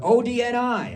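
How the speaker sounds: high, loud shout of letters; yelled out. Retold or imitated. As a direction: imitate a woman heckling from the crowd. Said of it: "she yelled out".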